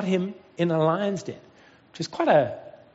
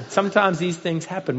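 normal talking voice

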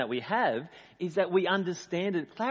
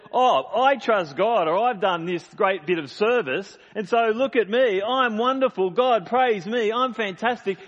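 loud, high, hammed-up self-praise; mock a believer puffed up with their own goodness; self-congratulating boast; 7 semitones higher, 8 dB louder than usual. Preacher mimics people proud of their own service, boastful. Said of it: "It's when we think that".

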